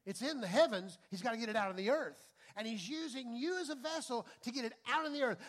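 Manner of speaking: loud, fast preacher's drive; preaching at full force. Real, not acted. Preaching climax builds.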